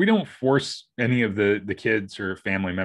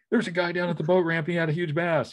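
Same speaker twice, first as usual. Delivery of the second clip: much higher-pitched imitation of eager news; imitated excited report; 7 semitones higher than usual. Husband imitating his wife's report of a huge bass, eagerly. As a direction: pitch it up like someone bursting with news. Wife reporting a big fish.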